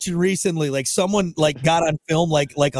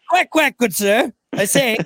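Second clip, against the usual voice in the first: high, slow, lordly quacking; posh duck voice; 5 semitones higher, slower than usual. Pretend. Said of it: "do some quacking".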